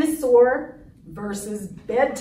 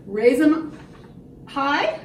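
plain reading voice

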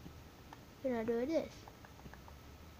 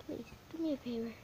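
ordinary talk, nothing marked

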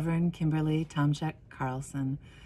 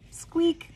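high squeaky toy noise; a quick high-pitched squeak; 4 dB louder than usual. Acted. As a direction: squeak it like a rubber dog toy. Chewy toy sound in story.

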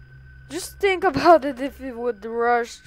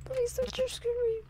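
higher, fast, scared begging; frightened pleading; 12 semitones higher, 6 dB quieter, faster than usual. Real, not acted. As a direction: beg fast in a panicked voice. Begging the animatronic.

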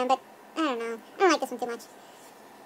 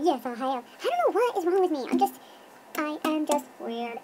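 ordinary delivery

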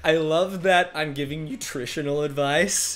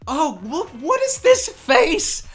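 shocked disbelief; 11 semitones higher, 4 dB louder, slower than usual. Real, not acted. Cringing at his old video.

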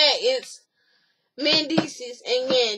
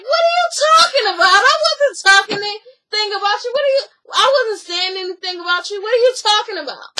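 very high, loud and fast; imitated shrieking denial; 8 semitones higher, 7 dB louder, faster than usual. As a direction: shriek that you said nothing. Acted quarrel, shouted denial.

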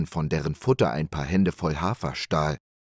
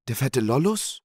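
hoch gefragt, verwundert, ansteigend; ungläubig nachfragen; 6 semitones higher than usual. A narrator's reading. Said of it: "fragte verwundert der Wirt". (Wirt wiederholt den Namen verwundert)

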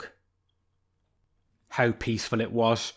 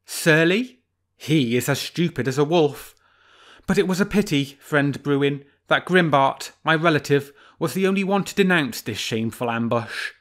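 sly fox; 3 semitones higher than usual. Acted. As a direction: play the fox, teasing the bear.

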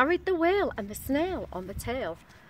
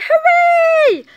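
very high, loud long cheer; villagers' shout; 16 dB louder than usual. A narrator's reading. Villagers cheer the rescued whale, high joyful shout. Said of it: "these are the villagers shouting".

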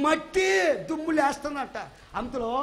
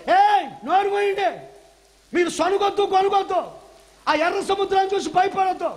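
stern raised rebuke to the crowd; preacher voicing Moses shouting at grumblers; Moses scolding the people; 6 semitones higher, 7 dB louder than usual. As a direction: scold them loudly to hush. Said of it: "అందుకే మోషే అంటాడు".

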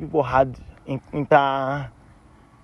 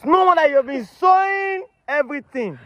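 high, slow shout of dawning outrage; outraged realisation; 9 semitones higher, slower than usual. Real, not acted. Host learning about the pastor, outraged realisation.

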